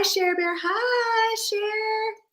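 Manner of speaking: ordinary delivery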